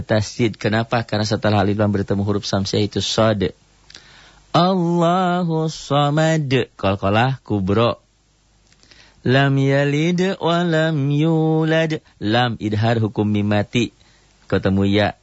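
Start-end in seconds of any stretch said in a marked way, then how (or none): 4.5-6.5 slow, high melodic tartil chant; Quran recitation. Shown as a demonstration. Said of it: "teacher recites Quran verse". 9.2-12.0 slow, high melodic tartil chant; Quran recitation. Shown as a demonstration. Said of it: "teacher recites Quran verse".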